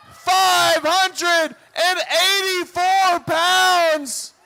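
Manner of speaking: loud, pumped arena announcer; hype announcer. Acted. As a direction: yell the lifter through the pull. Announces Carson, cheers the lift.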